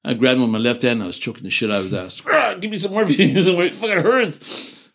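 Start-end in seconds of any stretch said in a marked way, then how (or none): 2.3-4.9 high, strained mock cry for morphine; slow, high pained begging; pained plea. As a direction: whine it out like a wounded soldier begging. Veteran retells begging for morphine.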